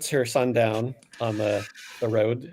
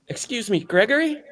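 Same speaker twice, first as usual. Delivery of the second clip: woman calling; 13 semitones higher than usual. Acted. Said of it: "a woman's voice go".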